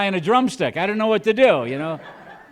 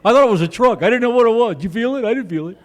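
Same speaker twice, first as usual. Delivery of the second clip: higher, quick-fire bystanders comparing notes; hammed-up casual surprise, pitched up; mock puzzled chatter; 5 semitones higher, 3 dB louder, faster than usual. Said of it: "people go".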